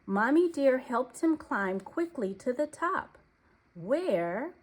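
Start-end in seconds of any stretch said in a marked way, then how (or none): none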